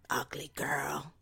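hushed, slow Eastern European insult; whispered Eastern European sneer. Retold or imitated. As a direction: breathe the insult in her accent. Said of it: "indeterminate Eastern European accent".

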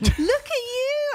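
high, slow singsong mockery; sister's teasing. Sister ribbing speaker for fancy word, high drawn-out teasing. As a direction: coo it high, mock impressed. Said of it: "And she went".

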